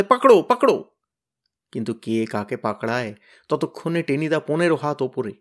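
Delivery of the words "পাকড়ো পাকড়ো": high, loud call to grab him; urgent shout. Acted. Kyabla yells to catch the flying Tenida, urgent shout. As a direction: yell it out running.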